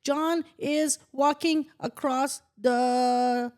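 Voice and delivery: high, slow, stumbling read-aloud; slow halting reader. Retold or imitated. Mocking classmates who read slowly, halting child reader. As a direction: sound out each word like a weak reader. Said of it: "And people were reading, like".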